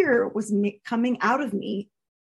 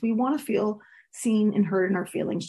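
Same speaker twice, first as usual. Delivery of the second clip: ordinary talk, nothing marked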